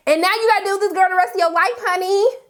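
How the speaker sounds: quick, high teasing "honey"; fast, high-pitched sassy aside; sassy fast warning. Acted. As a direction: rattle it off with a smirk. Teasing Issa about Condolences.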